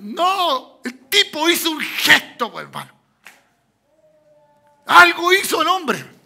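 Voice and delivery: loud, high preaching shout; fiery pulpit cry; full-force preaching. Real, not acted. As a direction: drive it home to the congregation.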